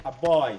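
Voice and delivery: weak আ, then punch বয়; stress on boy. Shown as a demonstration. Teacher demonstrating the stress of আ, বয় to the class, weak article then stressed বয়. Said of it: "এইখানে যদি আমি একটা উচ্চারণ করি".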